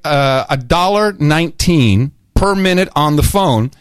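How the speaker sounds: normal talking voice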